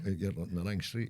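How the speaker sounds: Sean Connery. Retold or imitated. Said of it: "you can get his voice".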